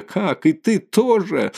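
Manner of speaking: high, drawn-out outraged question; acted hysterical indignation; indignant accusation. A narrator's reading. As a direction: turn on a new enemy in high-pitched disbelief. Katerina rounds on the landlady.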